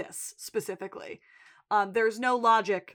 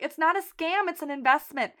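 mildly excited, pitched-up reciting; excited child; 6 semitones higher than usual. Retold or imitated. Daughter presenting information she just learned, excited child. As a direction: imitate a kid proudly sharing new facts. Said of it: "a child excited to present information".

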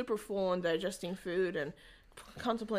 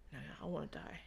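much softer, low murmur; softer, lower, resigned; hushed despair; 5 semitones lower, 12 dB quieter, faster than usual. Retold or imitated. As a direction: let the words sink away. Retold death wish, almost dropped.

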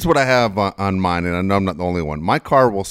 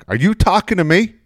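high, loud indignant mom; mother snapping at daughter over group text, mock indignant; mock angry mom; 11 semitones higher, 5 dB louder than usual. Mom's angry text, retold.

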